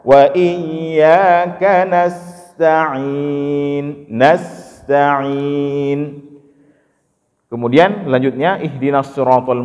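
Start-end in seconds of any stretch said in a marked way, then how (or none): 0.0-6.0 slow chanted "nasta'in" with the sin flowing into ta; no cut in the middle of "nasta'in"; connected reading. Shown as a demonstration. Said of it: "jangan kita potong sin itu".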